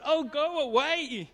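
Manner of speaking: high, slow fed-up sigh of "go away"; weary dismissal. Retold or imitated. Sick of herself, a slow fed-up dismissal. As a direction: brush yourself off, exasperated.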